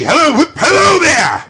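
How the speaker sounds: high, loud calling out; joyful whoop. Acted. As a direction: whoop with delight. Scrooge whooping.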